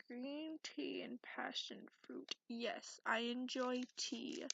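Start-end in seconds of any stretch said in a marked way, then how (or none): none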